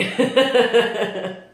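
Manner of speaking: loud, high burst of laughter; real laugh. Real, not acted. Vlogger amused by wife's drive-thru question, real loud laugh. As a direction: crack up at your own story.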